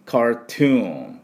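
ゆっくり高めに「カートゥーン」; 「カートゥーン」の各音節を長く伸ばす; 英語発音の手本. Shown as a demonstration. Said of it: "なんて言うかというと". (英語風に「カートゥーン」、最後のNまでゆっくり)

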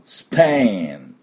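スペイン slow, ending on ン with lips open; slow スペイン, the final ン never closing the mouth. Shown as a demonstration. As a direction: let the ン of スペイン ring with lips apart. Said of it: "口を閉じちゃダメですよ".

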